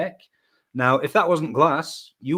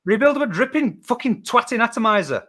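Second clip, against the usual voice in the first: high, loud insistence; worked up, pitched high and loud; exasperated rant; 9 semitones higher, 6 dB louder than usual. Real, not acted. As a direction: shout the doubters down. Arguing down his teasing friends.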